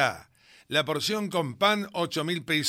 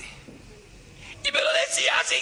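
shrill indignant wife, much higher; mock outrage at the husband; outraged shriek. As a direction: scream it in disbelief.

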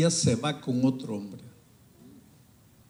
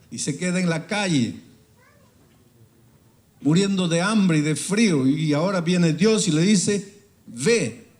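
rising preaching; 6 semitones higher, 7 dB louder than usual. Acted. Preaching builds to climax.

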